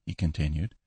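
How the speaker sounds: plain reading voice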